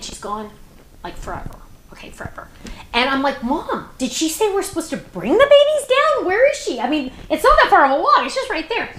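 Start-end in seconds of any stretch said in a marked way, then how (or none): 1.0-2.5 hushed, unvoiced "forever"; whispered aside. Real, not acted. Aside about how long she was gone. 3.4-8.9 higher, quicker worried questions; a touch of retold worry; puzzled retelling.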